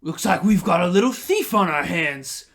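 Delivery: lightly voiced smug bandit; thug catching a thief. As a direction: lean in like you caught them red-handed. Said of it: "and he says".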